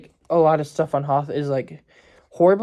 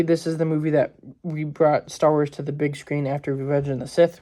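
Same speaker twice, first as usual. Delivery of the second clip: ordinary talk, nothing marked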